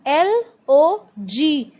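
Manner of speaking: ordinary talk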